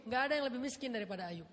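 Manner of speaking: ordinary delivery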